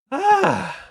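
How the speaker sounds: high-pitched sigh; frustrated sigh. Real, not acted. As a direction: sigh high after a loss. Just lost the night.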